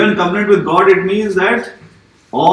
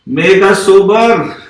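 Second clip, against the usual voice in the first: prayer; 4 semitones lower, slower than usual. Acted. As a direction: pray it humbly, drawing out the pleas. Pastor prays aloud to the Lord.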